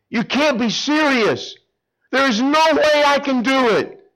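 high, slow, desperate whine; pleading Moses. Retold or imitated. Moses refusing God, pleading. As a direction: beg off in panic. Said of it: "Moses says".

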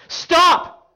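loud, high command, easing slightly at the end; yelling. Retold or imitated. Jesus stopping Peter's sword attack, yelling. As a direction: shout to halt a fight at once. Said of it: "Jesus yells".

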